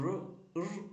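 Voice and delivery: "റ്" as a short rolled "ru"; letter sound r. Shown as a demonstration. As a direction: say "റ്" as the sound of R, not "ar". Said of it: "pronounce ചെയ്യുന്നത്".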